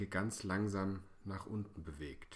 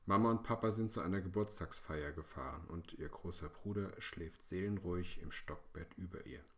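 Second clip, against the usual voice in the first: ordinary delivery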